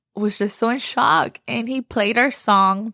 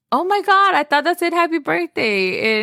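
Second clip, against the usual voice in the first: excited guests; 8 semitones higher than usual. Retold or imitated. Late guests reacting to the Marry Me letters, high excited gushing. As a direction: gush like a thrilled party guest. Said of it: "came after us were like".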